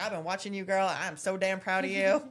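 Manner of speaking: warmly imitated Southern accent with a laugh. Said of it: "perfect Southern accent".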